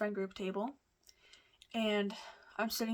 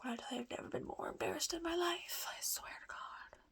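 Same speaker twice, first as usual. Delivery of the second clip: fast, breathy whispered confession; embarrassed whisper; 8 semitones higher, 6 dB quieter than usual. Real, not acted. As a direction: whisper it as though the memory still makes you cringe.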